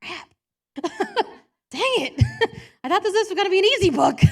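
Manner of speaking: high, loud and fast, laughing through it; laughing frustration. Retold or imitated. Caught by God's lesson, laughing in dismay. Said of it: "And I'm like".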